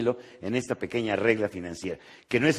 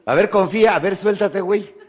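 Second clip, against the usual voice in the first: laughing mock command; 6 semitones higher, 4 dB louder than usual. Mocking God's command.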